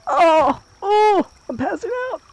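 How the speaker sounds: high, loud hammed-up swoon; mock fainting. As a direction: overact the collapse. Narrating character fainting in game.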